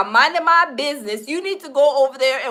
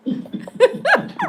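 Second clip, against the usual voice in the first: high, airy burst of laughter; real wheezing laugh; breathless laugh; 12 semitones higher than usual. Real, not acted. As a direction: crack up at the clip. Reacting to clip, bursts out laughing.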